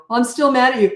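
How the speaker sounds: answering the apology with a mild mock huff; mock offended person. The wronged person brushing off an apology, lightly mocking. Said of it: "and then they go".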